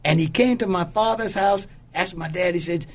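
ordinary talk

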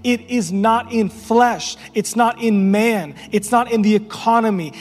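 fervent pulpit preaching, hammering not; preaching at full force. Acted. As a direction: preach it, building with every line.

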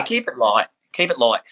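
"light" said as "loight"; broad Aussie "light". Shown as a demonstration. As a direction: round the vowel of "light" into "oi", Aussie style. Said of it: "L O I G H T".

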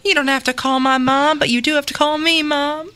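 melodramatic Southern mother, begging; Southern accent. Acted. Mother begs son to let her back, Southern accent. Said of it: "do this in a Southern accent".

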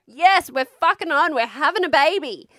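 high, loud triumphant cheer; re-enacted jubilant shout; excited celebration. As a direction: celebrate with a high-five whoop. Retold high-five as labour starts.

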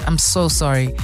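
ordinary talk, nothing marked

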